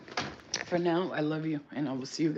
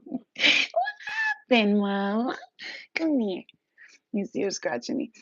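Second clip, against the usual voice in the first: higher pet-talk coo; 6 semitones higher than usual. Real, not acted. To the cat scratching her, in baby talk.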